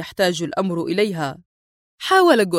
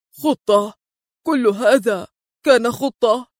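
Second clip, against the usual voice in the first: mildly dazed disbelief, broken by pauses; stunned; slower than usual. A narrator's reading. Goldman learns it was all planned, stunned. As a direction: question it in halting astonishment. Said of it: "قال جولدمان في ذهول".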